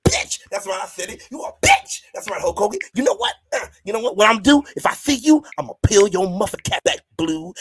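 loud hammed-up promo rant; mock wrestling promo. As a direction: cut a loud, mock trash-talking promo. I can't do the voice.

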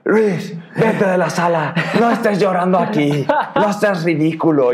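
high-pitched mock scolding yell; imitated shouting dad. Dad ordering his crying son out, imitated angry yelling. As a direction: scold like an exasperated father. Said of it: "mi papá gritándome".

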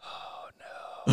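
breathy whispered oh no; whispered dread. Retold or imitated. Boy whispering to himself as mom calls. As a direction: whisper it like you're caught.

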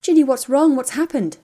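high, loud, urgent questions; anxious friend calling out; worried alarm. Acted. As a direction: demand to know what happened, frightened. Hermione finds Ginny sobbing.

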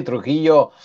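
ordinary talk, nothing marked

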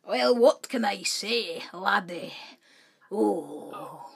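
slow put-on Scottish brogue; mock Scottish accent. Podcaster showing off a jumble of accents, mock Scottish. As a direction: ham up a slow Scots accent. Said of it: "Fourteen accents in one".

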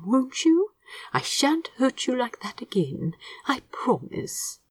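soft, whispered comfort to a plant. A narrator's reading. Aziraphale soothing the jade plant, softly whispered. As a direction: soothe the little plant gently. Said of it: "speaking softly as he did so".

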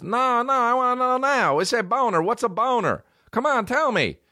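put-on very high, insistent little-kid voice; pestering kid imitation. Cousin pesters mom to tell him, shrill mock-kid insistence. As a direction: imitate a kid who won't drop it.

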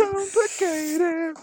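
very high, very slow fake sob; mock crying. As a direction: wail it like a hammy crybaby. Mock wailing the English word.

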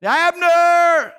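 high, loud drawn-out holler; calling out. Acted. David calls to the sleeping army, loud holler. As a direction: holler a name across a wide space. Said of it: "he called out to the army".

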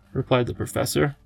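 usual speaking voice

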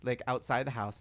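ordinary delivery

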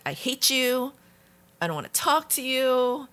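mock angry child. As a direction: toss it out like an angry teenager. Child's hostile words quoted.

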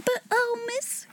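high, soft, breathless-with-wonder plea; eager cry. A narrator's reading. Becky interrupting Sarah in her excitement, eager cry. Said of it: "cried Becky".

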